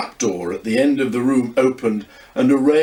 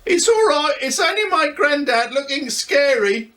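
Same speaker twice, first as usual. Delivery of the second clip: put-on higher, lively young boy voice; boy Luca. A narrator's reading. Luca teases grandad about looking scary, young boy voice. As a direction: play a cheeky young boy teasing grandad. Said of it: "he shouted".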